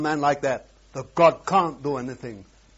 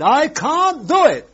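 high, loud voice of God refusing; God's voice; 12 semitones higher, 6 dB louder than usual. Acted. Preacher voicing God to the congregation, loud and high. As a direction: voice God declaring he cannot act.

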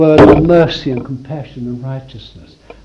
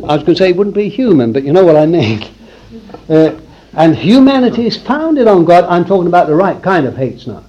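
ordinary delivery

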